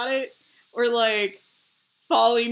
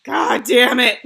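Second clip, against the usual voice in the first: loud, high exasperated wail; mock mad; 8 semitones higher, 13 dB louder, much slower than usual. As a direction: scream in hammed-up fury, ending in a laugh. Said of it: "I just get mad at myself".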